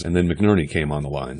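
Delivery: ordinary delivery, nothing marked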